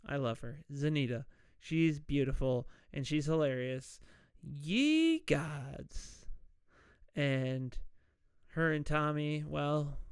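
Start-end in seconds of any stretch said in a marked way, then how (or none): 4.4-6.2 long, swooping "Ye gods"; catchphrase impression. Podcaster fondly mimicking hilarious Zanita, long swooping exclamation. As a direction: stretch out her comic exclamation. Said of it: "that she says that".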